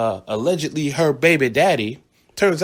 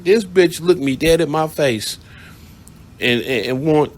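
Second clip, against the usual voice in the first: ordinary talk, nothing marked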